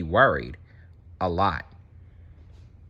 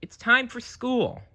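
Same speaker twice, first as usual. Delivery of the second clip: put-on high eager child voice; little Nemo. Nemo telling his dad it's time for school. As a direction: chirp it like an excited kid. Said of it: "Nemo called out".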